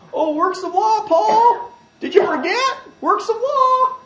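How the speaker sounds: very high put-on voice; mock eager answer. Galatians supposedly answering Paul, mock-naive and high-pitched. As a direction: squeal the answer like a silly know-it-all. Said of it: "Now, what did they answer?".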